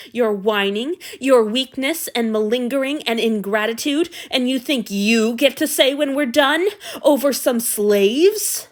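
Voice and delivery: rising to a loud rant; building anger. Acted. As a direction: let the rage swell. Abuser's building rage.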